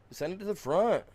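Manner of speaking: high, loud order to the game; irritated yell. Real, not acted. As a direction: snap the command at the screen. Gamer yelling at game.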